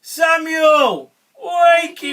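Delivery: high, loud storybook call. A narrator's reading. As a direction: call loudly to rouse a sleeper. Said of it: "God called again".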